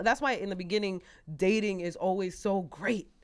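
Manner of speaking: ordinary talk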